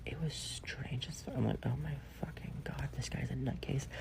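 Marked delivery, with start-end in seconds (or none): 0.1-1.3 slow whispered aside; hushed whisper. Real, not acted. Describing weird behaviour. 1.7-3.9 breathy secret whisper; horrified whisper. Retold or imitated. Inner reaction to crazy guy.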